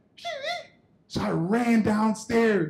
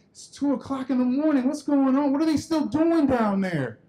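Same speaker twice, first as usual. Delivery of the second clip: high-pitched, acted-out disbelief; incredulous kid; 6 semitones higher than usual. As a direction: wonder aloud, pitched up, at the late hour. Boy puzzled by late noise.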